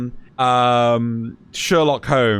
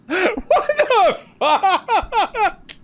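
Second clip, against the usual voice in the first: much higher, laughing squeak; laughing disbelief; much slower than usual. Real, not acted. Cracking up at a friend's mock taunt, much higher.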